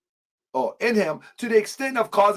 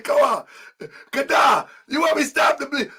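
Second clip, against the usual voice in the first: mock frantic yell; 5 semitones higher than usual. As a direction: yell like a panicked friend begging for help. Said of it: "Kadar said".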